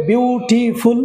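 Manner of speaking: "বিউটিফুল" slowly, split into three syllables; teacher showing syllables. Shown as a demonstration. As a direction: break "বিউটিফুল" into its three beats. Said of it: "এখানে তিনবার থামতে হচ্ছে".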